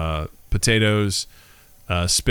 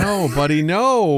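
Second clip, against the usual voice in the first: affectionate nagging; 6 semitones higher, slower than usual. Real, not acted. Teasing friend about not eating.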